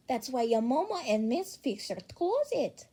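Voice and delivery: usual speaking voice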